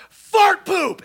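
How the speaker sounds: high, mock kid yell; shrill little heckler shout; kid's yell. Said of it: "and yells".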